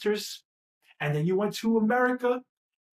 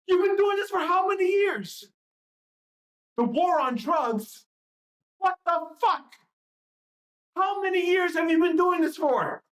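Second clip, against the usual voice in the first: raised, higher, shouting at the world; exasperated rant; 8 semitones higher than usual. Real, not acted. Ranting at humanity over endless folly, angry shout. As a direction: demand an answer, fed up.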